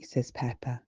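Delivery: plain reading voice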